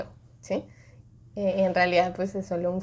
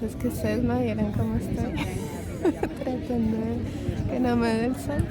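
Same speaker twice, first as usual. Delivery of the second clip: normal talking voice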